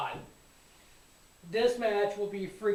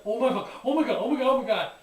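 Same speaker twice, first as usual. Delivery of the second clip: louder, breathless repeated oh my god; excited; 6 dB louder, much faster than usual. Retold or imitated. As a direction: gush at the TV screen.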